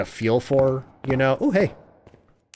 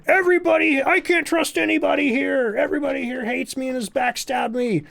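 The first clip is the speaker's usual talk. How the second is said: over-the-top heel promo, pitched way up; mock dramatic John Cena; 13 semitones higher than usual. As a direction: play the betrayed wrestler big and whiny. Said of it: "he was like".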